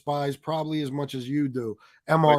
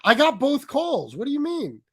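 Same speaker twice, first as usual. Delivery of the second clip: higher, slow, put-on innocent voice; imitating his denial; 7 semitones higher than usual. Mocking the actor who denies the second call, high and slow. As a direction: play dumb in a drawn-out, lifted voice. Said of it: "It's like".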